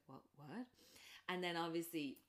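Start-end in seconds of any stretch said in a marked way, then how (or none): 0.1-0.7 can't believe it; baffled mutter. Retold or imitated. Alison baffled.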